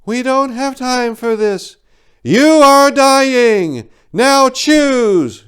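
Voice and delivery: high, slow commanding bird woman; urgent otherworldly female creature; eagle spirit voice. Acted.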